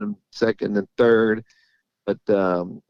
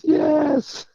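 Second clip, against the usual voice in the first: imitated emotional scream. Jockey's wife telling him they won, imitated high-pitched squeal. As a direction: imitate an overjoyed, crying yes in a high voice. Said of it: "she's yelling and screaming and everything".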